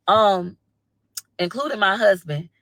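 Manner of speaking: normal talking voice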